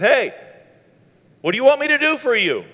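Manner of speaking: loud, high casual yell; brisk shouted question; hollering. Acted. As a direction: call across the street to someone. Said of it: "he just hollered over".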